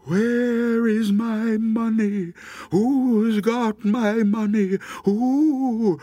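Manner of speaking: slow, high wail from far off; high, eerie drawn-out call; ghostly crying. A narrator's reading. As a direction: draw it out like a wailing ghost. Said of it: "It was a voice crying".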